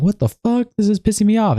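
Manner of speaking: high-pitched mock outrage; imitated annoyance. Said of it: "she's gonna be like".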